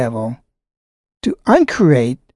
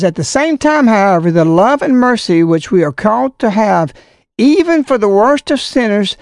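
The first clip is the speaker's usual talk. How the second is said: formal letter reading; 3 semitones higher than usual. Acted. Reads bishop's letter aloud.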